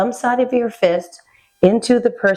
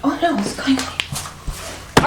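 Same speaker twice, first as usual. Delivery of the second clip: alarmed; 5 semitones higher, 4 dB quieter, much slower than usual. Acted. Acted collapse in training scene.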